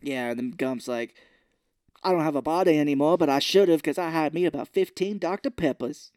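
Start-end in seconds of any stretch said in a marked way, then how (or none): 2.0-6.1 goofy Gump voice. Retold or imitated. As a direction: ham up the Gump with a country twang. Voicing Gump character in retelling.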